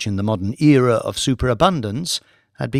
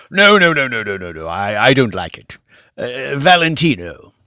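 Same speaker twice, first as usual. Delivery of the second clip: louder, dismissive lord waving the idea away; lordly refusal; 6 dB louder than usual. Acted. Lord rejecting Billy's suggestion, dismissive. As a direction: shoot it down, then turn to Valentino.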